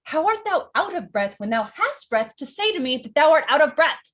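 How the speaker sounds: loud, high-pitched theatrical outburst; exasperated. Acted. Juliet calls out the stalling nurse, loud exasperation. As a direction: build indignantly to the final word. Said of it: "Then she just goes".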